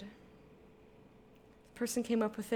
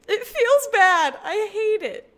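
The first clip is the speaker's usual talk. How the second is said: high, loud, drawn-out wail of complaint; loud complaining; 10 semitones higher, 9 dB louder, slower than usual. Real, not acted. Streamer lamenting getting off the computer early, loud complaining. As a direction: stretch the words out in protest.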